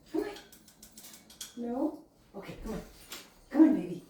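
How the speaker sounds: high, soft praise for a bird; talking to a pet. Real, not acted.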